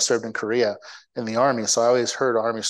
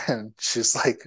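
normal talking voice

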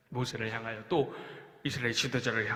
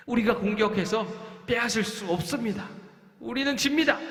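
panicky spy; 7 semitones higher than usual. Acted. Spies' fearful report.

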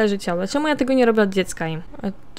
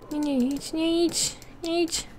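pleading no; 7 semitones higher, 6 dB quieter, slower than usual. Real, not acted. Begging a Sim not to go.

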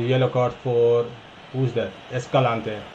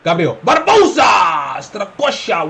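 loud, drawn-out commentator call; excited shout; 5 semitones higher, 7 dB louder, slower than usual. Real, not acted. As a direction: scream the name as he shoots. Second chance, calling name.

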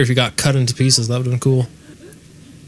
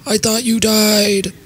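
character bit; 6 semitones higher than usual. Pretend. Player carrying on the old man bit, put-on voice. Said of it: "Stop stealing my bit".

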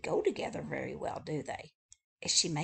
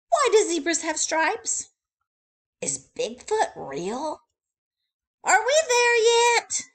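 high, eager rattling list; chatty bird voice; 11 semitones higher, 11 dB louder than usual. Acted. Wordy Birdie telling readers what she likes, high and eager. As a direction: gush through the list.

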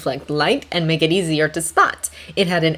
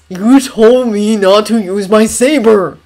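defensive; 4 semitones higher, 8 dB louder, slower than usual. Acted. Anakin defends himself.

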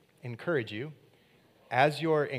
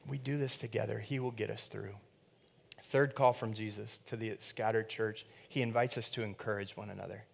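ordinary talk, nothing marked

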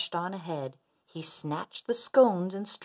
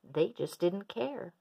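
ordinary delivery

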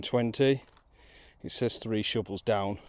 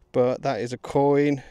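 louder, slow proud statement; excited; 3 semitones higher, 9 dB louder, slower than usual. Real, not acted. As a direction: savour the find. Repeats the coin find loudly.

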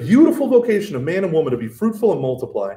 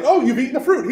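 mock God; 8 semitones higher, faster than usual. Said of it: "God's like".

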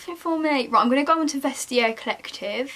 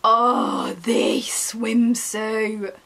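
slow admiring; much slower than usual. Real, not acted. Admiring swimsuit.

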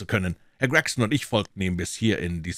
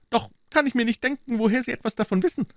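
very high, loud character voice; Gregson-style police detective voice; 4 dB louder, faster than usual. Acted. Detective answering Holmes at the door, high put-on voice.

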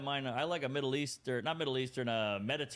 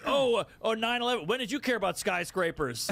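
higher mock whine; 6 semitones higher than usual. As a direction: sneer it, pitched up. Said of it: "it's like".

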